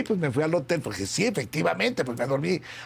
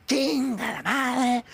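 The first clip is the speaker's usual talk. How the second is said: high, slow mock cursing; exasperated curse; 9 semitones higher, much slower than usual. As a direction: imitate a fed-up boss, stretching the curse out high. Boss cursing at lateness, acted. (maldición exasperada, imitada aguda y lenta)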